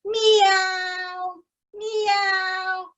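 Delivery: high cat meow; high, drawn-out cat meow. Acted. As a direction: mew like a cat. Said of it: "the cat was meowing".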